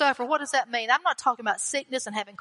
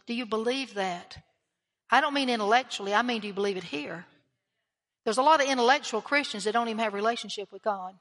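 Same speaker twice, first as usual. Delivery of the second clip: normal talking voice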